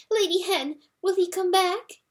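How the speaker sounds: high, anxious little mouse voices; frightened mice. A narrator's reading. Terrified mice begging the hen for news, high and anxious. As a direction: squeak it out like scared mice.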